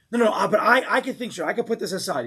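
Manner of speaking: high, loud protest breaking into a laugh; mock angry denial. Angry man claiming he can think straight, heated and high. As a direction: insist you're fine while clearly boiling. Acted angry person insisting calm.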